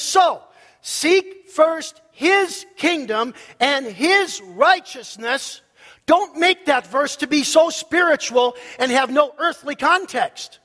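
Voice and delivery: ringing, insistent pulpit delivery; emphatic preaching. Real, not acted. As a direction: proclaim it with punched key words. Preaches at full force.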